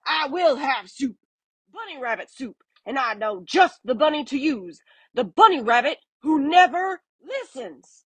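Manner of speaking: high, lightly voiced menacing varmint; villain varmint voice. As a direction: promise bunny soup with relish. Varmint's threatening speech.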